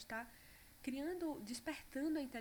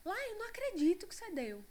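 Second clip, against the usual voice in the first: much higher, louder mock call from afar; husband calling out; 11 semitones higher, 7 dB louder than usual. Mother imitating her husband calling out from the other room. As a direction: shout it from the next room, disbelieving. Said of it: "meu marido já gritou de lá".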